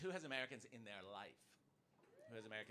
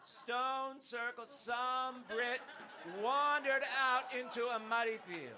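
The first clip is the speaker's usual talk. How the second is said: high, loud, very slow mock-epic telling; mock grand storyteller; 10 semitones higher, 9 dB louder, much slower than usual. Comedian mocking Brits, mock-epic slow grand telling. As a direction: intone it like a solemn legend.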